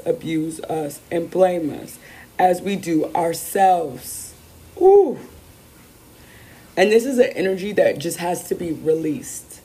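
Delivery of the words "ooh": excited ooh. Real, not acted. Reacting to the card's message.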